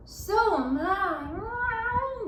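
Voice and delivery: very slow, high meowing cat. A narrator's reading. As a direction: stretch it like a cat's meow. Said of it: "the cat".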